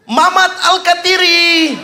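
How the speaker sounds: high, drawn-out call from afar; teriakan memanggil. Acted. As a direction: holler the name across the street. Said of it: "Dia teriak dari ujung sana". (berteriak memanggil dari jauh)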